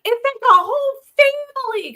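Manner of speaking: high, loud squeal of wonder; squealed like an amazed little kid; excited kids. Retold or imitated. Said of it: "Arthur and Charlotte were like".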